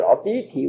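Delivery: Atithi said as aw-TEE-thee, soft dental t's; Atithi, first syllable like aw, then tee-thee with breathy t; Sanskrit word. Shown as a demonstration. Teacher showing a guest how to say Atithi, Atithi with soft dental t's. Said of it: "we say Atithi".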